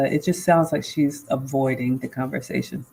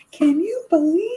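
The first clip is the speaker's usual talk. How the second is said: high, incredulous squeal; mock outrage; 12 semitones higher than usual. Retelling her upset moments to her guy, high incredulous squeal. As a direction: squeak it in disbelief. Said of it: "one of those".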